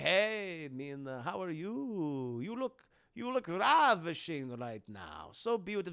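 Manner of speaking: slow, mock-seductive vampire voice; Dracula. Dracula flatters Mina, slow hammed-up seduction. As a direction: draw out the compliments like a suave count. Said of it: "Dracula's like".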